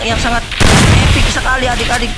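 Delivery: put-on lively play-by-play for kids; mock sports commentary. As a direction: call the match like a hyped caster. Live game play-by-play for kids. (komentator pertandingan yang bersemangat)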